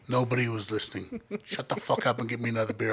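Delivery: low, very soft grumble; hushed, dismissive retold order; grudging retort. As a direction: brush it off under your breath. Brother's muttered retort.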